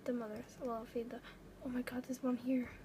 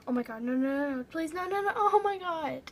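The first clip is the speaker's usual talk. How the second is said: panicked, loud pleading no's; terrified horror victim; 3 semitones higher, 10 dB louder than usual. Real, not acted. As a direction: scream it as you get eaten. Cell about to be eaten.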